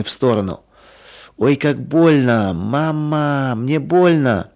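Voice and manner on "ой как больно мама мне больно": slow, high, drawn-out lament; pained wailing. A narrator's reading. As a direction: whimper for mother in pain. Stung child wailing for mother.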